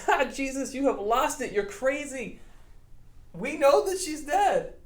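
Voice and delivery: mock him as if he's lost it; mocking crowd. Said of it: "They were like".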